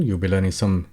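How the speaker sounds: ordinary talk, nothing marked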